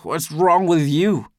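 slow, high, loud mocking echo. A narrator's reading. Drunk Eldest echoing his accuser, loudly mocking. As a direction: throw the roar back as mockery. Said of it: "still mocking".

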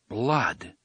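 ordinary delivery, nothing marked

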